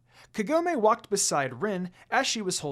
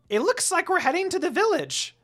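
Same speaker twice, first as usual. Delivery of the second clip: high, loud eager little servant voice; high loud imp reporting to his master; squeaky servant imp; 13 semitones higher, 6 dB louder than usual. Acted. Jokin voice.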